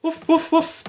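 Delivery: high, loud yappy woof woof woof; high puppy woof. Acted. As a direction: yap it out like a little puppy. Reader imitates the puppy.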